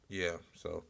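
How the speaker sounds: normal talking voice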